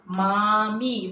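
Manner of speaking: मामी very slow with long final ee; मामी drawn out, both vowels long. Shown as a demonstration. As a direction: stretch मामी, holding the mee. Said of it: "आपला दीर्घ उच्चार झाला मीचा".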